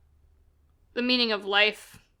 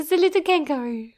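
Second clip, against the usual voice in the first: higher-pitched put-on French accent; 6 semitones higher than usual. Echoing a favourite film line in a French accent. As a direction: mimic a cute French woman's accent. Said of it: "the way she says that with her accent".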